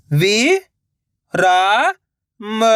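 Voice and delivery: "वि", "रा", "म" each alone with pauses; syllable by syllable. Shown as a demonstration. Teacher breaking the word up for learners, syllable by syllable. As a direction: sound out "वि … रा … म", holding the first two. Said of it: "direct मात्रा का उच्चारण करके".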